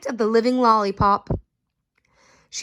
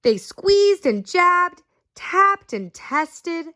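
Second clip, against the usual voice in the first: slow emphatic narration; 7 semitones higher, much slower than usual. A narrator's reading. Narrating the exam.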